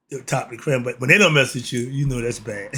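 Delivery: ordinary talk, nothing marked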